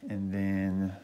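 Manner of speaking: ordinary delivery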